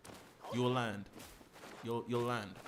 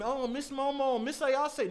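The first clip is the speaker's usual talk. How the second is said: lightly mimicked, higher drawn-out sweet-talk; mocking imitation; 8 semitones higher, slower than usual. Streamer mimicking Gigi calling on Momo, mocking. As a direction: mockingly echo a suitor, pitched up. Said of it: "where you keep saying".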